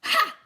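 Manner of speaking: loud high mocking ha; scoffing ha. Judge scoffing at Matthew, loud. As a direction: bark a dismissive laugh.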